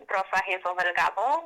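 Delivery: Rosa Hazel Delgado said the Spanish way, with rolled R; don't Americanize Rosa Hazel Delgado, say it in Spanish; name Rosa Hazel Delgado in Spanish sounds; Spanish pronunciation. Shown as a demonstration. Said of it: "I definitely don't Americanize it".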